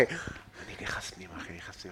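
scared retold whisper. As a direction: whisper it like he is sneaking back inside. Telling scary moment at the fence.

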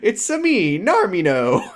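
put-on high-pitched comic Italian accent; bouncy video-game Italian accent; Mario. Co-host defending his doctor voice, hammed-up Italian accent. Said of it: "sounding just straight up Mario".

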